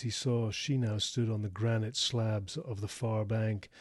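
usual speaking voice

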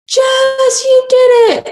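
lightly voiced husband, high and thrilled; excited husband. Husband greeting wife at the finish line, excited. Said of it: "he was like".

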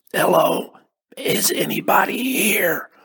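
croaky, whispery calling out; hoarse call. A narrator's reading. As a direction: call out weakly with a rough voice. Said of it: "The sound of my voice surprised me".

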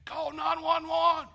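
high, loud panicked call for help; a bystander's high panicked cry; alarmed shout. Retold or imitated. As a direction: yell it out in alarm as if someone is choking. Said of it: "somebody said".

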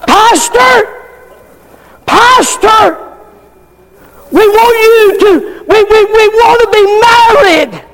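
high, whiny plea; mock pleading couple. Preacher voicing a couple begging the pastor, high whine.

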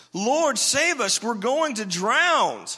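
high, slow cry for help; panicked shout. Retold or imitated. Disciples shouting at sleeping Jesus, panicked high yell. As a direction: yell it like sailors sinking in a storm. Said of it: "shouting, yelling at Jesus".

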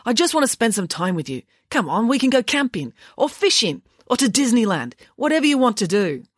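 eager, high-pitched pleading; wheedling dad, voice lifting brightly; coaxing father. A narrator's reading. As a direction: tempt your son with treat after treat. Father coaxes son to come along.

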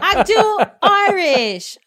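put-on loud, slow, laughing Irish accent; mock Irish accent. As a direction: boast in a hammy Irish accent.

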